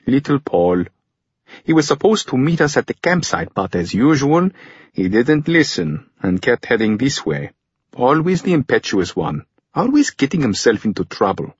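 character voice, mildly put-upon; exasperated older brother. Acted. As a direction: complain fondly about a wayward younger sibling. Voices older brother grumbling about Paul.

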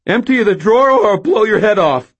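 high, loud shout with a crack. A narrator's reading. Nervous teen robber threatens cashier, shouting. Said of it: "I shouted".

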